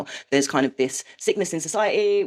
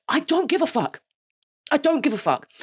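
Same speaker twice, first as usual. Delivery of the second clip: defiant outburst; 9 semitones higher than usual. Real, not acted. Venting anger at the relationships.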